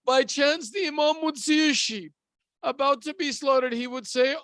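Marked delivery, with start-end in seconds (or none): none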